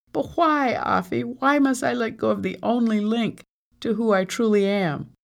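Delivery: high, distressed pleading; pleading protest. A narrator's reading. Amari begging Afi not to make her forget, pleading protest.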